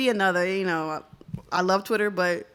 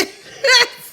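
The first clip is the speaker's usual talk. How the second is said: loud high laugh; 13 semitones higher, 10 dB louder than usual. Real, not acted. Laughs at the clip.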